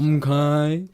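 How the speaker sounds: high, loud, drawn-out "m'kay"; cartoon catchphrase accent, rising at the end; Mister Mackey. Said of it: "Not with the accent".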